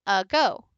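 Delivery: ago stressed on its second syllable. Shown as a demonstration. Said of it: "rose a little bit".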